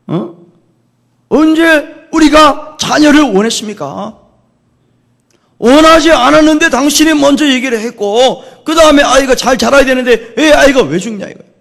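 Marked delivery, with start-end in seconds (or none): 1.3-11.5 high, loud, acted-out tirade; angry outpouring. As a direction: pour out a mother's furious reproach. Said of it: "막 퍼붓기 시작하는 거예요".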